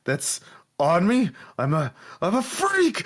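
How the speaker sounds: distraught brute. Acted. GM voices distraught NPC.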